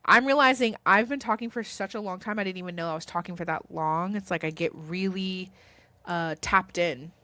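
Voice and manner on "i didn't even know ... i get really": ordinary delivery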